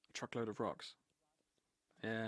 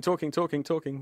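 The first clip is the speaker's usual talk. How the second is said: usual speaking voice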